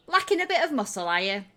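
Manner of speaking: high, loud, quick jibe; brisk, high-pitched mockery; teasing Shrilt. Shrilt teases the adventurers.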